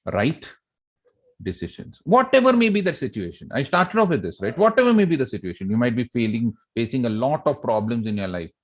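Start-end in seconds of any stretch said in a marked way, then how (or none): 2.0-5.7 excited emphasis. Real, not acted. Motivational speaker raising emphasis.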